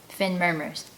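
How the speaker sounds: ordinary delivery, nothing marked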